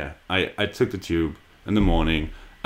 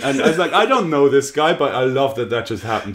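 laughing; 7 semitones higher than usual. Real, not acted. Laughing through the story.

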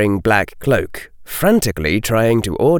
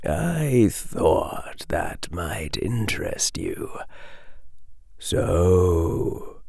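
slow, hushed, knowing whisper; slow sly whisper from the mist; satisfied whisper; 3 semitones lower, 7 dB quieter, slower than usual. A narrator's reading. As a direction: whisper it, pleased with yourself. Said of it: "the voice whispered in satisfaction".

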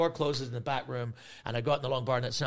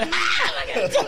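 loud, high imitated guffaw; mock laughter; 8 dB louder than usual. Imitating comedians who think he's joking, loud mock laughing. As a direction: burst out in exaggerated group laughter.

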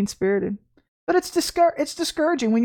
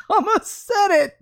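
said through a laugh, higher; 9 semitones higher, slower than usual. Real, not acted. Catching her own slip, laughing. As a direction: giggle out the words.

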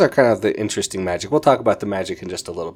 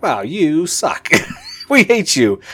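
slow, much higher, whiny sneer; mock kids' taunt; 9 semitones higher, 5 dB louder, slower than usual. The kicked-out kids jeering at Victor, slow and high. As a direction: drag it out like sulky teens. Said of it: "they're like".

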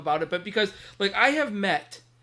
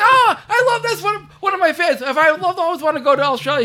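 gushing retold fan; 10 semitones higher, 6 dB louder than usual. Retelling his gushing to Ian.

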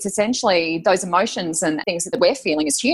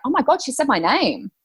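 retold surprise, higher pitch; surprised realisation; 7 semitones higher than usual. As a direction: re-enact a sudden astonished realisation. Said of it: "I was just so surprised".

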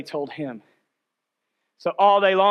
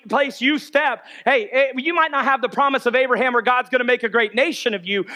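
ordinary talk, nothing marked

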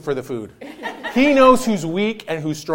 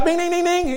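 bell imitation; 9 semitones higher, 4 dB quieter than usual.

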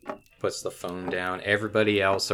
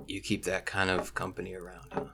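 soft, sighing, lightly voiced boss; weary NPC voice; 2 semitones lower, 9 dB quieter than usual. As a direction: hush it with a tired sigh. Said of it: "and just sighs and says".

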